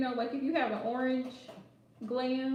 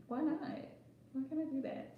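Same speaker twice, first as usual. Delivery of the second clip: mock innocent; 2 semitones higher, 8 dB quieter than usual. Acting innocent in imagined breakup.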